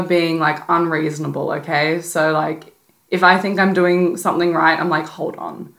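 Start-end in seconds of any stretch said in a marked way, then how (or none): 5.1-5.7 breathy whisper to herself; hush, stop yourself mid-thought; a whispered hold on; whispered self-check. Retold or imitated.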